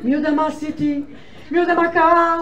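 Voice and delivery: spiral into worried questions; wife imagining the worst aloud; panicked worry. Retold or imitated. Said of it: "היא אומרת".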